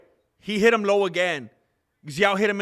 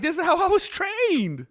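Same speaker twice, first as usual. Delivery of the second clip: high, light mock-earnest voice; imitating a fighter shrugging it off; mock innocent fighter; 12 semitones higher than usual. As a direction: squeak it out like an innocent excuse. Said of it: "He's like".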